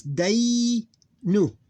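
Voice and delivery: day drawn out high, then short nu; two syllables. Shown as a demonstration. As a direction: split day, nu into long glide and short beat. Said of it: "two syllables".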